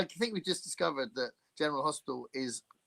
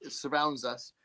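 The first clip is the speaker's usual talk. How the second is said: ordinary delivery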